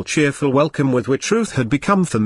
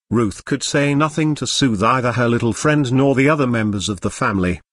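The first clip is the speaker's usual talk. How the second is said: ordinary delivery, nothing marked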